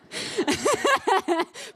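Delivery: bursting into a high laugh; a long, falling real laugh. Real, not acted. Audience exchange, speaker laughing.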